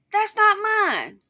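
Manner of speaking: high, loud, slow mock protest; indignant denial. Father denies the child is his, indignant.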